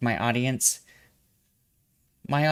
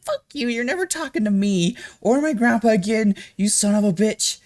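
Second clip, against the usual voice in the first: high, loud furious yell; retold shout; 9 semitones higher, 4 dB louder than usual. Telling off a phone scammer who called, loud shout.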